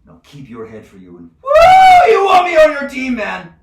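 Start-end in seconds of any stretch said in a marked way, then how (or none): 1.4-3.5 high whooping shout; whoop, then yell the warning at full force; wild boast. Acted. Judge boasts and warns.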